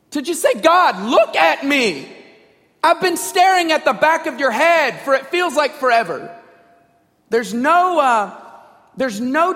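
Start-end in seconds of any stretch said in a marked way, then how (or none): 0.5-6.4 loud, high complaint to God; irreverent demand. Acted. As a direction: confront God with exasperated insistence. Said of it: "how irreverent is that".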